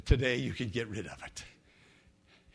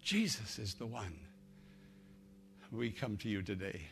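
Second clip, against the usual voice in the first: prayer; 6 dB quieter than usual. Acted. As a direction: pray softly over music. Leads a prayer.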